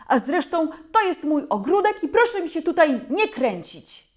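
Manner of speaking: loud, high angry scolding; mother shouting. A narrator's reading. Mother scolding the mob from the porch, loud angry shouting. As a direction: tear into the crowd like a furious mom. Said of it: "umie krzyczeć głośniej niż najpotężniejszy głośnik".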